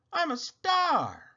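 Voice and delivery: delighted. Acted. Delighted at her reflection.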